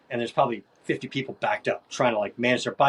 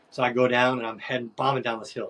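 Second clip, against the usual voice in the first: usual speaking voice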